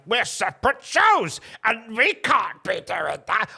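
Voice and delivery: high, slow, exaggerated mimicry; mock company line. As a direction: draw it out as a silly objection. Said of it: "it's very much like".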